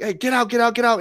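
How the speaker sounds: mildly heated re-enactment, not shouted. Retold or imitated. Player orders shooter off the field, mildly heated. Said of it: "I was heated about it".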